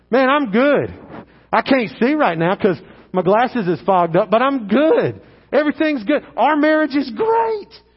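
loud, overdone cheerfulness; hammed-up false positivity; pretending everything's okay. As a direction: insist far too forcefully that all is well. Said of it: "We just like to pretend like everything's A-okay".

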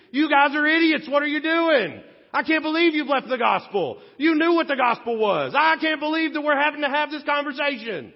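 Paul opens his letter by scolding the Galatians, angry and exasperated. Pretend. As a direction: tear into them like a furious apostle. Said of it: "starts tearing them a new one".

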